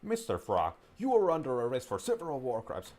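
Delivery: high, loud pretend officer; hammed-up official accusation; mock arrest. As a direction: declare a play-acted arrest with authority.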